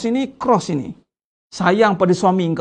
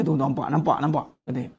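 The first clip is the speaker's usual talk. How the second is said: devil whispering; 7 semitones lower, 7 dB quieter, faster than usual. Retold or imitated.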